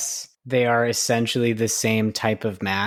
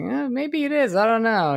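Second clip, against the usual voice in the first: much higher, wavering pitch of doubt; unsure musing; 12 semitones higher than usual. Retold or imitated. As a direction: float it up high, unsure. Retelling own doubt about injury.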